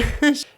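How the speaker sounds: a quick rising chuckle; short laugh. Real, not acted. Host laughing at her own rain joke, a brief chuckle.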